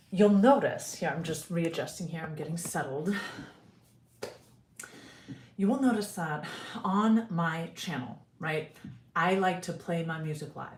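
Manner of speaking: ordinary delivery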